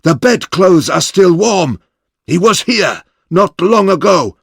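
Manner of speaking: high excited shouting, urgent to the end; rally the men to find him. A narrator's reading. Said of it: "he shouted".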